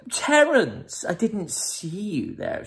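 high, put-on feminine greeting; posh girlfriend. Girlfriend notices Terence standing there, high posh greeting.

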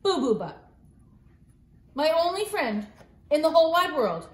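ordinary delivery, nothing marked

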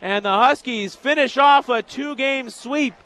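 loud, high play-by-play voice; elated sportscaster closing out the game; triumphant final-out call. Real, not acted. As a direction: announce the win with full excitement. Calls the final out live.

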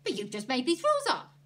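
indignant exclamation; exclaiming. A narrator's reading. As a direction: object in loud disbelief. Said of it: "Ernie exclaimed".

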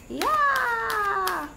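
high, drawn-out happy yeah; excited cheer. Real, not acted. Reader cheering to viewers after the promo, high excited yeah.

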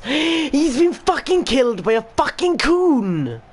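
shocked outburst. Real, not acted. Gasp at player being fouled.

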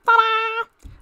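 a high, loud sung "Ta-da!" like a magic reveal; showman's ta-da; ta-da fanfare. Pretend. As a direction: trumpet it out as a flourish. Mock fanfare after pronunciation question.

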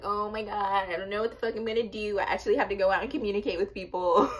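high, quick, half-laughing fake despair; mock panic. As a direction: ham up being lost without her best friend. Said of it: "I'm just like".

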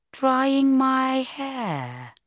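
very slow, high, drawn-out admission; sheepish realisation. Acted. Bridget realising why she missed the bell, sheepish. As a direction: stretch it out as it dawns on you.

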